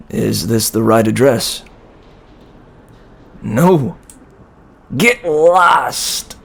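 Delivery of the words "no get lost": high, snapped-out put-on voice; rude neighbour. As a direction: bark it off like a rude tenant. Door slammed, rude neighbour voice.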